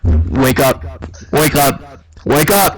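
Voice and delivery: loud, punchy repeated command; acted mom shouting someone awake; urgent wake-up call. Mom rousing her dreaming son, loud sharp command. Mom voiced waking him.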